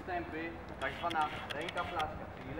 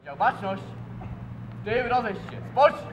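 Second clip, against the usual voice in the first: drill command; 4 semitones higher, 9 dB louder than usual. Real, not acted.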